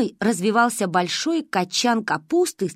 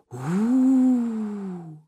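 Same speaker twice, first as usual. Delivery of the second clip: howling wind. A narrator's reading. The narrator imitates the wind howling at her back, a long howl. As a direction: moan it like a wintry wind.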